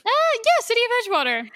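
high, loud mock cheer; triumphant shout. Real, not acted. Mocking friend's city answer with a loud high cheer. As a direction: crow it out like you won.

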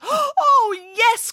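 very high, urgent little chicken; high-pitched storybook panic; excited cry. A narrator's reading. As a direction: cry it out in a squeal of alarm. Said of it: "cried Chicken Little".

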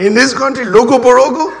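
high, baffled echo of the odd name; incredulous train man. Acted. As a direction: question it in mock disbelief, rising at the end. Baffled clerk repeats the name.

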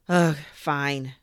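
loud groaning concession; fed-up and grudging; exasperated surrender. Acted. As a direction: give in with a loud huff. Boy gives in to toy.

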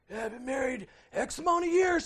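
lightly voiced proud husband bragging; a hint of a smug husband; proud man. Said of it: "says the proud man".

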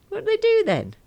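high teasing jabs; a hint of gossipy friend. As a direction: needle her knowingly. Bev teasing on phone.

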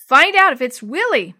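loud, high urgent exclamation; urgent cry. A narrator's reading. Girl urging the boy with the flashlight, crying out. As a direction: cry it out, desperate to know. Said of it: "Maria cried".

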